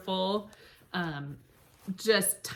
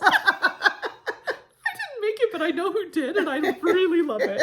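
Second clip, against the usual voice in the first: laughing; 8 semitones higher, 5 dB louder than usual. Real, not acted. Laughing while gushing about quilt.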